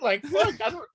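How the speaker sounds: words breaking into high laughter; laughing. Real, not acted. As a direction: laugh through the words, pitch way up. Laughing at unemployment hassle.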